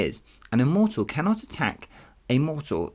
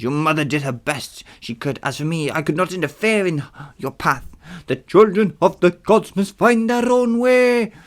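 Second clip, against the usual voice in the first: put-on voice climbing higher and louder; Hermes; 8 semitones higher, 5 dB louder than usual. Hermes warning about the children of the gods, put-on voice. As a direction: warn them, rising to a high pitch. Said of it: "Hermes warned".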